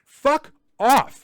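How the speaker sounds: high, loud fuck off; angry outburst. Real, not acted. Host snapping at politicians, loud angry burst. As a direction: spit it out in disgust.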